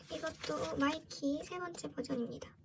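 usual speaking voice

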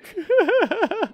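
short high giggle; high laugh. Real, not acted. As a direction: laugh it off lightly. Laughs at busy viewer.

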